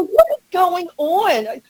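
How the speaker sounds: high, slow exasperated cry; disbelief. Retold or imitated. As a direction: ask it in stunned disbelief. Frustrated quote, high pitch.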